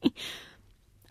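very soft, unvoiced muttering; hushed aside to himself; mumbled into his beard. A narrator's reading. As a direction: mutter it slyly with a little snicker. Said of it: "nuschelte in sein Bart".

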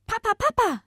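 very high, loud yappy woofs and calls; high-pitched puppy voice; little puppy calling. Acted. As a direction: yap for Papa like a little pup. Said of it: "eine Stimme aus der Nähe".

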